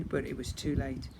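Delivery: ordinary delivery, nothing marked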